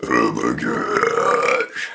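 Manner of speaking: words pushed out in one long deep burp. Real, not acted. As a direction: burp every word slowly. Talking while burping, burp challenge.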